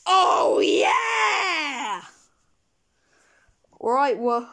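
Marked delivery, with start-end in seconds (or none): 0.1-2.0 high, loud yell of delight; wild cheer. Acted. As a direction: shout it out on a sugar rush. Sugar rush cheer.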